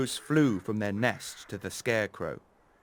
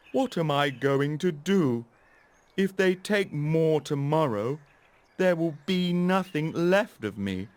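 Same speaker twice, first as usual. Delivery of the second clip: worried scarecrow; 5 semitones higher, 3 dB louder than usual. Acted. Scarecrow frets over lost stuffing.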